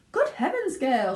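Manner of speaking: high, loud alarmed cry; shrieked. Acted. Mother notices Violet's nose, shrieking. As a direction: shriek in shock. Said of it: "shrieked Missus Beauregard".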